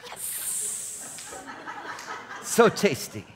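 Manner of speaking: breathy whispered yes; a secret, whispered victory cheer; whispered gloat. Acted. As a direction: whisper your triumph to yourself. Savoring a won argument.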